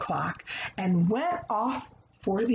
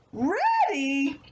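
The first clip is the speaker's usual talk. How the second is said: gloating little pig; 6 semitones higher than usual. Acted. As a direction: crow over outsmarting the wolf.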